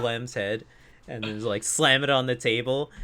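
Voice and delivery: ordinary talk, nothing marked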